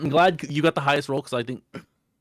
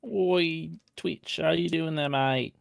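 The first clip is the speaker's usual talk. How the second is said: put-on Australian accent; 6 semitones higher, 4 dB quieter, much slower than usual. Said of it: "is this the Australian episode".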